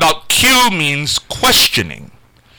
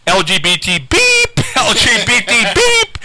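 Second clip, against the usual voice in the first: imitating the bleeped replay; 8 semitones higher, slower than usual. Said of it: "that Exchange Club was playing".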